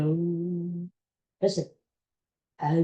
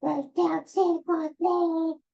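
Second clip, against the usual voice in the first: put-on much higher, slow squeaky kid singing; 10 semitones higher, slower than usual. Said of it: "with my squeaky high voice".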